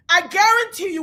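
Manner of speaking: usual speaking voice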